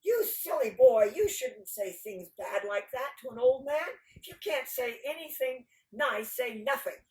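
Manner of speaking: put-on indignant old storyteller's lecture; scolding village storyteller. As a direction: rebuke a silly boy sternly.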